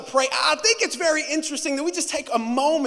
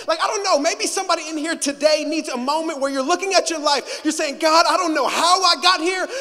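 higher and louder, climbing to a shout; preaching at full force; 5 semitones higher, 7 dB louder, faster than usual. Real, not acted. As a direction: build to a rousing preacher's shout. Sermon climax.

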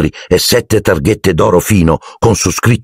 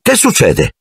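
put-on higher, puzzled boy's voice; Ron's voice; 11 semitones higher than usual. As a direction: ask what's going on, boyish. Ron asks Harry. (voce da ragazzo più acuta)

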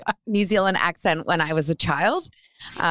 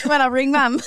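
New Zealand phrasing; 7 semitones higher, 3 dB louder than usual. Shown as a demonstration. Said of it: "when I call my mom".

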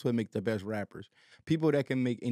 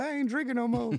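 daughter voice; 9 semitones higher than usual. Retold or imitated. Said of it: "She like".

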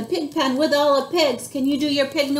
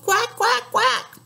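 duck call; 9 semitones higher than usual. Acted. Papa Duck calling his ducklings, high quacks. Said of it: "Papa Duck called".

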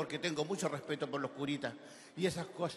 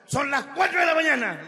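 loud, high acted telling-off; high-pitched indignant outburst; scolding wife; 9 semitones higher, 9 dB louder than usual. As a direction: scold him loudly as the fed-up wife. Wife scolds husband at night.

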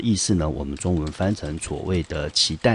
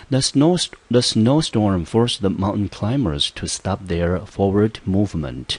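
the snowstorm sentence read slowly and louder, word by word; slow example reading; 3 semitones higher, 4 dB louder, slower than usual. Shown as a demonstration. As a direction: read the example carefully for students. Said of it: "来，我们看例句". (放慢并加大音量朗读例句)

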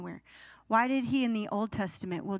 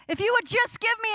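put-on very high, louder childish begging; whiny pleading child; 13 semitones higher, 5 dB louder than usual. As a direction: whine at God like a toddler. Whining prayer like a child.

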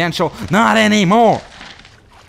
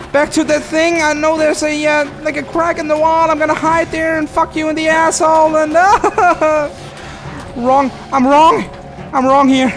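much higher, louder breathless chatter; high-pitched panicked rambling; frantic panic; 12 semitones higher, 4 dB louder than usual. Real, not acted. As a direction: babble in fear while running. Running, panicking in game.

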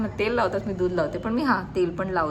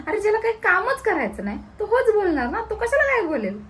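much higher, ranting about her brother; indignant rant; 13 semitones higher, 3 dB louder than usual. Real, not acted. भावाच्या आळशीपणावर चिडून बोलणारी बहीण, उंच रागावलेला आवाज. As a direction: pitch it up in outrage.

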